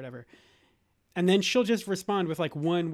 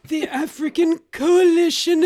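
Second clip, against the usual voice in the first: high, slow, loud English stateswoman; Margaret Thatcher; 9 semitones higher, 8 dB louder, slower than usual. Acted. Dad performs his impersonation on request, high slow stateswoman voice. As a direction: drag out each word in a raised, declaiming voice. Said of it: "Oh, and Margaret Thatcher".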